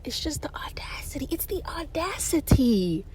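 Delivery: breathy whispered disbelief. Real, not acted. Summing up the story, whispered. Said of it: "whispered aside".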